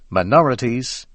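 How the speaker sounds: usual speaking voice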